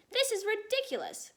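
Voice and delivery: high-pitched indignant cry; cried out. A narrator's reading. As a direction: blurt it out in outrage. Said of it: "cried the Air Marshal".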